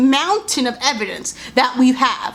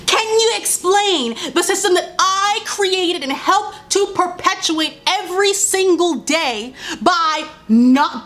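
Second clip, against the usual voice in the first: slower mock questioning, pitched up; mocking oppressor; 7 semitones higher than usual. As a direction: sneer it back at them. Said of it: "loves to ask".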